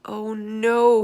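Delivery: loud, high cry of alarm; dismayed cry. Acted. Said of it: "he cried".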